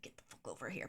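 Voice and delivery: low, quiet mock-angry order; pissed. Pissed host ordering guest over, low quiet retold anger. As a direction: imitate him fuming under the breath. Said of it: "he's pissed and he's like".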